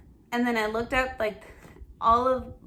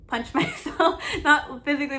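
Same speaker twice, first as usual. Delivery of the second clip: laughing; 5 semitones higher, 5 dB louder than usual. Real, not acted. Laughing at own phrase.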